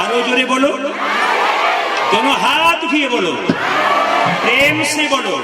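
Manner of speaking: high, loud crowd rallying; rousing call. Real, not acted. Urging the crowd to chant louder.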